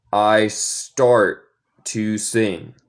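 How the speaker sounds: "I start to sing." drawn out slowly; very slow teacher model. Shown as a demonstration. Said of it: "yo como los T's".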